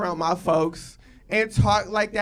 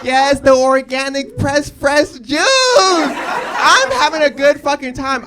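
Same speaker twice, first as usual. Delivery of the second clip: ecstatic; 4 semitones higher, 9 dB louder, slower than usual. Real, not acted. Exaggerated delight shouted.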